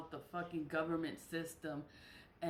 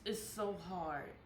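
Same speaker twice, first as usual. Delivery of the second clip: ordinary talk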